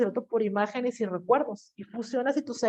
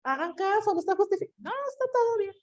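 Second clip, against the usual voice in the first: high, insistent scolding voice climbing higher; nagging brain; 8 semitones higher than usual. Retold or imitated. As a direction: plead with them to listen. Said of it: "el cerebro les está diciendo".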